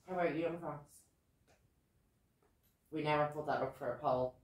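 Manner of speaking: ordinary talk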